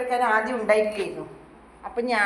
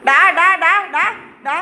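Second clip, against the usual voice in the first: loud sharp calls; shouting at dogs; 6 semitones higher, 12 dB louder than usual. Real, not acted. Owner shouting to stop the dogs. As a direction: bark the word out to stop them.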